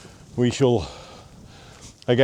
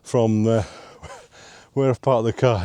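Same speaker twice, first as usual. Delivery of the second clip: ordinary talk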